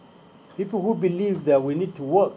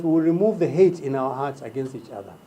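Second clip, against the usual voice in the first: usual speaking voice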